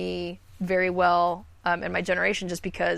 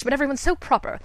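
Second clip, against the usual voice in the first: put-on higher, proper old-Hollywood mid-Atlantic accent; 7 semitones higher, faster than usual. Shown as a demonstration. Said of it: "Everyone talks like this".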